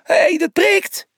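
high, loud ouch of protest; startled, indignant squeal; pained yelp. A narrator's reading. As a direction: yelp as if jabbed from behind. Zebra is poked and protests.

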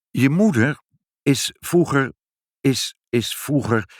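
halting start with repeats and pauses; faltering confession. A narrator's reading. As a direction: stall before breaking difficult news. Mother struggles to break the news.

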